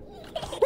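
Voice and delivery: sudden high startled gasp; sharp gasp. Real, not acted. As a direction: catch your breath in fright. Startled by monster in game.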